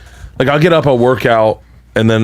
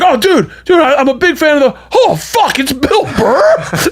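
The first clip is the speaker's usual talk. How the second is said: high-pitched, gushing fan; imitating an excited fan; starstruck fan; 12 semitones higher than usual. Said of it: "You're getting more excited than they are".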